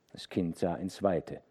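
ordinary delivery, nothing marked

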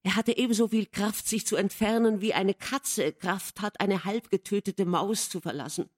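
ordinary delivery

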